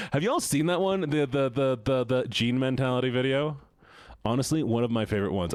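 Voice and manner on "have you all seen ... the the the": ordinary talk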